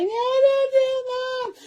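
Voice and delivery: put-on high, pleading kid voice; begging little brother. Bleeding brother pleading with his sister, high and desperate. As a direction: squeak it out like a scared boy begging.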